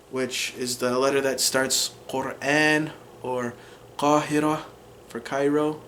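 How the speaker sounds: ordinary talk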